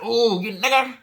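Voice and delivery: high, mocking sneer of contempt; imitating a bigot's contemptuous tone; hateful sneer. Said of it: "They say it in a way where like".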